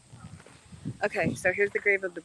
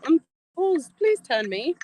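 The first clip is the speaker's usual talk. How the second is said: loud, high imitation of British guide; hammy British accent, repeating it; 10 semitones higher, 8 dB louder, slower than usual. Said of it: "Why does he have an accent?".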